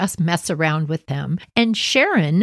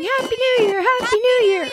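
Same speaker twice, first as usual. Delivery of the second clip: much higher, bright call; cheery shout. Retold or imitated. Little sister calling through the parking lot, high cheery. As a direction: sing it out like a kid celebrating. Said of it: "She's running around the parking lot going".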